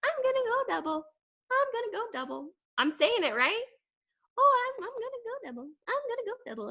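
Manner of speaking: mock daydreaming about success; dreamy wishful voice. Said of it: "I'm sitting here and I'm like".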